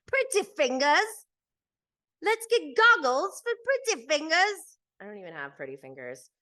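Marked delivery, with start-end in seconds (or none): 0.1-1.3 high, slow, loud teasing nickname; hammed-up snooty high voice; mock posh voice. 2.2-4.8 hammed-up high snooty voice; mock posh voice. Mock producers' line repeated.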